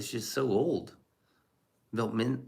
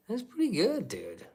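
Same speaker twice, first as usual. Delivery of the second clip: mock high voice; 12 semitones higher than usual. Mock reaction to chat.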